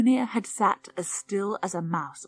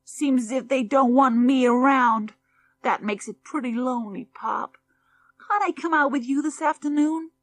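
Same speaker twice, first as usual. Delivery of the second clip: high, loud, pleading complaint; lonely son begs his father to take him along; sulky boy; 7 semitones higher, 6 dB louder than usual. Acted. Junior pleads with Pop.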